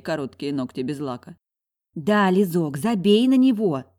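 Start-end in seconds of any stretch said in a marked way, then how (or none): 1.9-3.8 louder, drawn-out chiming in; поддакивающая подруга. A narrator's reading. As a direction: back your friend up loudly, stretching the words. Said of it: "поддакивала Светуля". (громко поддакивая, нараспев)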